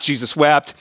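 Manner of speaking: "Jesus wept" said low in pitch; low reading. Shown as a demonstration. Reciting same verse from many versions.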